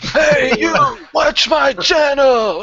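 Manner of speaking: hammed-up high, loud yell; loud, attention-grabbing holler, stretched out; mock shouting. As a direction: shout it like you're hailing a stranger. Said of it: "You just shout at them on YouTube".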